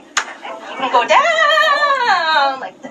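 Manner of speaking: drawn-out strained cry going down; straining stretch. Real, not acted. Comic shows crowd her flexibility, straining cry. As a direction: squeal through the effort of a split.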